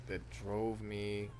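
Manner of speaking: slow, dragged-out delivery; a failed first try, mumbled slowly; botched take. Shown as a demonstration. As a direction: let the line stall and sag. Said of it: "That was terrible".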